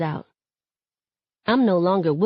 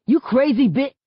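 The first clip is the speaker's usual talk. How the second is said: angry outburst; 7 semitones higher, 4 dB louder than usual. A narrator's reading. Brother confronts girl, cut off.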